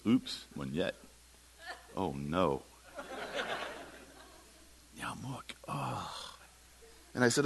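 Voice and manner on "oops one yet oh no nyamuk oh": small, soft, sinking voice; deflate, dropping low and quiet in dismay; sheepish realization. Retold or imitated. Tenant realizing his word mix-up, sheepish.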